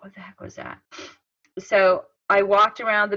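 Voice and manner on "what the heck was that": very soft, under-the-breath wondering; hushed retold thought. Woman hearing a noise from the woods, hushed thought. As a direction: drop to a hushed aside to yourself.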